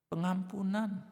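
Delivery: usual speaking voice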